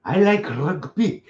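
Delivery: clumsy mock Welsh accent. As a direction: play a comic Welshman badly in a Welsh accent. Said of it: "You don't do Welsh accent".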